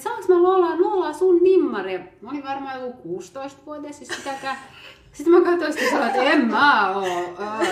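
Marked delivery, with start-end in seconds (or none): 0.0-2.0 put-on high, loud, pleading child voice; excited child shout. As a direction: beg like a starstruck girl. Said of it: "se vaan kiljui ja huusi". (innostunut tytön kiljahdus)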